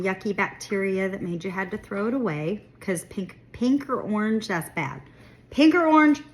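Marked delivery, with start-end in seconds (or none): 5.5-6.2 loud warning, pitched up; emphatic. Real, not acted. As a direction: stress the danger.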